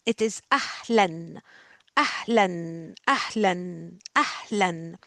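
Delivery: أهلًا repeated low, final -an held; tanween on أهلًا. Shown as a demonstration. Teacher drilling the class on أهلًا, low and steady. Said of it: "key that you pronounce it that way".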